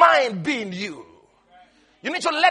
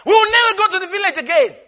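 higher, louder indignant outburst; indignant kids; 8 semitones higher, 4 dB louder, faster than usual. Retold or imitated. Offended grandkids protest the insult, high and indignant. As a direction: protest loudly, half laughing. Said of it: "the others will get angry".

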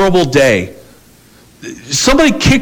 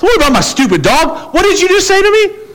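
mock indignant outburst. Acted escalating quarrel.

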